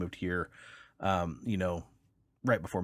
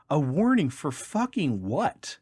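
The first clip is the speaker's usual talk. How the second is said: higher, slow, indignant outburst; angry wife; 7 semitones higher, 5 dB louder, slower than usual. Retold or imitated. Wife challenges trooper's warning, higher and slow with outrage. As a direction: spit it out slowly, furious at the warning.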